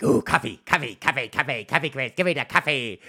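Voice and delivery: mimicked hyperactive child chanting; hyper little kid. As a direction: imitate an overexcited kid begging on repeat. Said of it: "little fucking little hyper kid".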